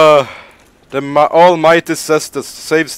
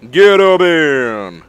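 loud, drawn-out holler; slow, raised-voice shout; playful holler; 3 semitones higher, 8 dB louder, much slower than usual. Pretend. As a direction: stretch it out in a loud call. Calling out while playing.

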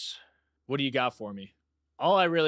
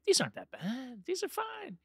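much higher, softer coaxing voice; tempting inner voice; 11 semitones higher, 7 dB quieter than usual. Retold or imitated. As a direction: sweet-talk like a sneaky inner voice. Voicing brain romanticizing mushrooms.

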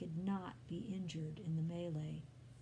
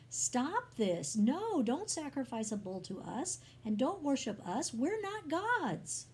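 high, emphatic pleading shout; urgent protest; 6 semitones higher, 4 dB louder than usual. A narrator's reading. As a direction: protest desperately, stretching the final words. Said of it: "Paul protested".

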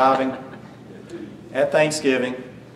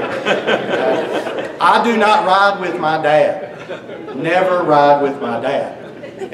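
laughing; 4 dB louder, much slower than usual. Real, not acted. Laughing at his own joke.

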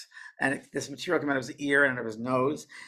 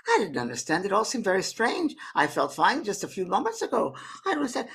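raised-pitch imitation of a woman; Scottish lady; 6 semitones higher than usual. Puzzled spirit lady describing her first moments after death, put-on higher voice. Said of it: "a lady from Scotland".

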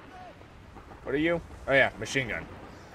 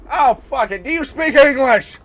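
loud, raised-pitch demand; fed-up yelling at the enemy; exasperated shout; 9 semitones higher, 9 dB louder than usual. Pretend. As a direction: give up and holler it. Gives up German, shouts at enemy.

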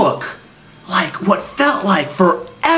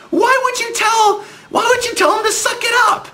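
incredulous shout; 12 semitones higher than usual. Real, not acted. Incredulous rant at the bookers.

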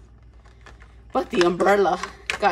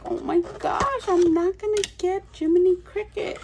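higher, mock-worried inner voice; mock panic; 9 semitones higher than usual. Fan retelling fear of missing the pre-order, mock panic. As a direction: replay your own little panic, higher. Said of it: "And I was like".

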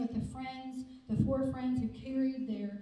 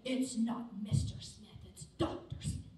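imitating stern correction. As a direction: correct him slowly and coldly, mock-stern. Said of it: "in the most stern voice".

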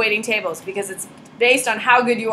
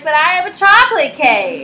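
put-on higher, louder stubborn kid voice; bratty kid insisting; 5 semitones higher, 7 dB louder than usual. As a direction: insist like a bratty four-year-old.

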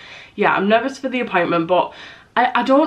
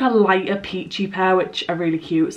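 normal talking voice

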